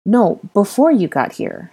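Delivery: "before" stressed and drawn out. Acted. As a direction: lean slowly on "before". Harry corrects the child's answer.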